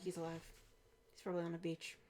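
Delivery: low, very soft inner monologue; private musing. Retold or imitated. Boss privately guessing where her missing agent is, hushed musing. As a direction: think it aloud under your breath, in short pieces. Imagining boss's private thoughts.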